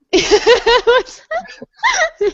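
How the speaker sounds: high burst of real laughter. Real, not acted. Answering the interviewer, a high real laugh.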